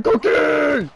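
high, loud drawn-out yell; frantic shout. Real, not acted. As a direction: holler it in alarm, stretched out. Calling out in frantic gameplay.

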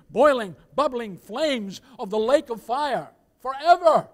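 loud, rolling hellfire preaching; forceful pulpit cadence, slowing at the end. Real, not acted. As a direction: pound each phrase of doom.

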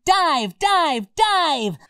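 high, drawn-out dive call; playful command. Pretend. Calls out a diving command.

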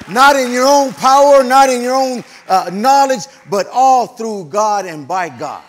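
loud preacher's proclamation, building in force; full-force sermon delivery; fiery preaching. Real, not acted.

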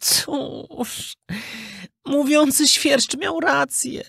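put-on high, tearful, regretful boy voice; sad puppet boy. Lonely puppet boy regrets running away, tearful and high. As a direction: admit he was wrong, near tears.

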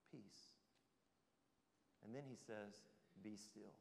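very soft, low stilling words; hushed calm. Acted. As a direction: hush the storm gently. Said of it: "Notice the first word Jesus says".

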